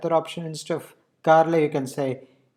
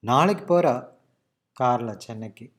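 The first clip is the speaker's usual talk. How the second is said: நாளைக்கு போறா raised, then pause and drop on கார்ல சென்னைக்கு; stress on நாளைக்கு; much faster than usual. Shown as a demonstration. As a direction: make நாளைக்கு stand out, let the rest fall away. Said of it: "key word is நாளைக்கு".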